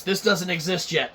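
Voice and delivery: ordinary talk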